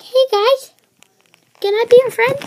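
high, sweet little critter voice, eager to make friends; cute creature voice. Pretend. Voicing the card creature as it greets the viewers in a high, friendly voice. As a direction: squeak it out like a shy little creature. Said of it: "He's like".

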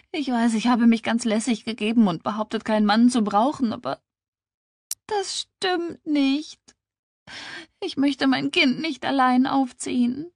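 high, tearful confession; weinerlich. Acted. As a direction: confess it in acted tears, dragging the admission. Weinende gesteht ihre Angst. (verzweifelt, mit weinerlich hoher Stimme)

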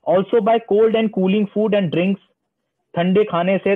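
ordinary talk, nothing marked

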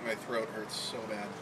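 soft, hurting voice; strained quiet voice. Real, not acted. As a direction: speak barely above quiet. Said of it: "I can't talk any louder than this".

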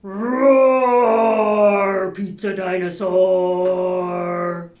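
loud, slow roaring dinosaur. Acted. Greeting the pizza dinosaur at the door, loud slow roar. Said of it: "Then what would you say?".

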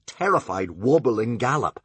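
usual speaking voice

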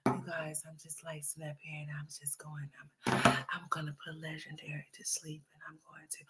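breathy mocking whisper of a vlogger. Reaction streamer mocking a vlogger's whisper, whispered. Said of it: "this whispering person".

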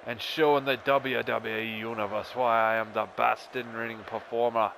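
wrestler's taunt. Acted. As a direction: taunt the bored rival at full volume. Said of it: "Here we go".

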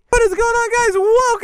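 high, excited greeting; upbeat show opener; intro catchphrase. Real, not acted. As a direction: hype up the viewers.

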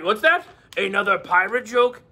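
ordinary talk

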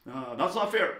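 playful imitation of a sore loser; mock child's protest. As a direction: object like a kid who lost the point. Voices the losing side's child complaining.

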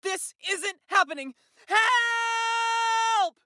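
loud cry building to a scream; panicked scream. Acted. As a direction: scream for help, high and drawn out. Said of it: "Scream all you want".

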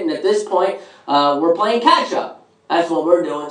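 ordinary talk

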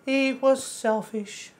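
high, slow cartoon mouse voice; squeaky, slowed-down Mickey impression. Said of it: "my sounds like Mickey".

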